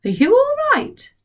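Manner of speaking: put-on polite boy. George politely asks Grandma if something is wrong. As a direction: ask politely and sweetly.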